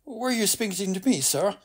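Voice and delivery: high, quick, affronted query; offended passer-by. A narrator's reading. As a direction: challenge a muttering stranger. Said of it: "the man's offended stare".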